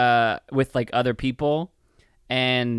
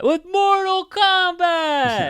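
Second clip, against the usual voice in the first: very high, slow, drawn-out shout; roaring the title in the game's announcer voice; Mortal Kombat announcer; 5 dB louder, much slower than usual. Pretend. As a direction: stretch it out like the game announcer. Echoing the game's announcer call.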